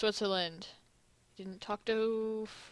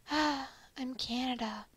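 timid sigh; 2 semitones higher, 4 dB quieter, much slower than usual. Acted. Shy Canada sighs his name.

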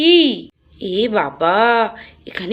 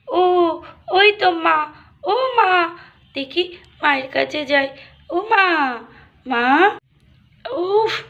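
put-on high small child calling for her mother; a young girl just woken, searching for mom; little girl voice; 7 semitones higher than usual. Little girl calling for her mother.

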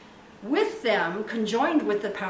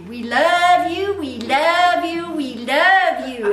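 loud, high, slow Texas-style holler; imitated Texan exuberance; 7 semitones higher, 12 dB louder, slower than usual. Preacher praying to God as the Texan friend would, exuberant Texas drawl. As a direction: stretch each "love" in a big Texas drawl. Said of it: "as my friend would say".

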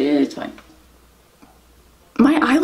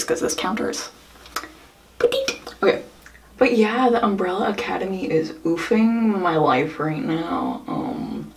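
ordinary talk, nothing marked

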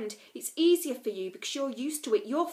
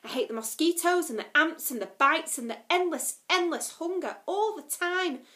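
loud, higher, bitter complaining; angry rant; 5 semitones higher, 6 dB louder than usual. A narrator's reading. Lila ranting about mosquitoes and hunger. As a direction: pour out the frustration.

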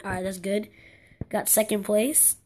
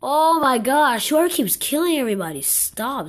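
loud, raised-pitch complaint; annoyed outburst; 5 semitones higher, 7 dB louder than usual. Real, not acted. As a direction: burst out at the culprit, exasperated. Annoyed at being killed.

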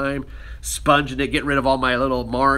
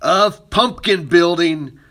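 slow, high, loud show-opening welcome; upbeat, drawn-out lesson intro; 5 semitones higher, 6 dB louder, much slower than usual. Real, not acted. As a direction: stretch the greeting out like a host opening the show. Video intro greeting.

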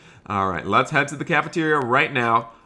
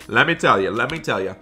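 ordinary talk, nothing marked

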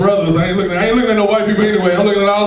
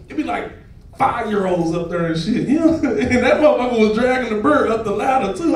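usual speaking voice

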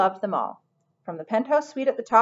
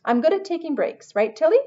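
high, piping little voice; 8 semitones higher, 4 dB louder than usual. A narrator's reading. Henry chiming in to Tilly about breaks, piping high. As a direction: pipe up eagerly in a high pitch. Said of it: "piped Henry".

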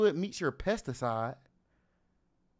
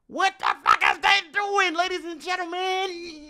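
shocked shout; 12 semitones higher, 3 dB louder than usual. Real, not acted. Shocked reaction to screaming song.

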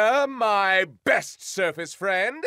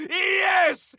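triumphant shout; 8 semitones higher than usual. Acted. Triumphant after cackle.

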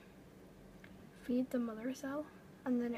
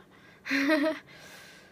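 big burst of laughter; loud laugh; 10 dB louder than usual. Real, not acted. Laugh after chant.

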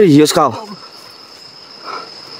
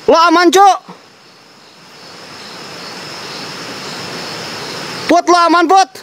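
very high, urgent questioning call; pitched-up worried shout-out; alarmed calling; 12 semitones higher, 5 dB quieter than usual. Real, not acted. Calling to friend who screamed.

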